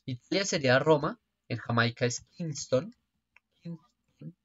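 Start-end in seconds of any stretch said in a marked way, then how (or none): 3.6-4.4 muttered to self. Real, not acted. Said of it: "echoing own answer".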